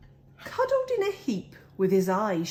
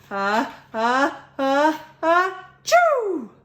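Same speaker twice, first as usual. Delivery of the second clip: ah-ah-ah rising higher and louder into a big choo; building sneeze; 7 semitones higher, 6 dB louder, slower than usual. A narrator's reading. Bear sneezing from the pepper fleck, building to a loud burst. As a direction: wind up a huge sneeze and let it burst.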